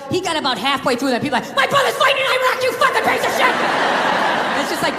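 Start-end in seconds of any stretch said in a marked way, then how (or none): 1.6-3.6 high, fast indignant yelling; mock furious outburst, pitched way up; outraged interrupter. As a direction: blurt it out like an outraged hothead. Outraged heckler cuts off explanation.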